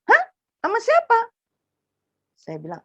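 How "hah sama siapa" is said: very high, loud shocked question; shocked brother. Retold or imitated. Brother on the phone demanding who teaches him, very high and loud.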